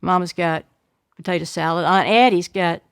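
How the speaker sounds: plain reading voice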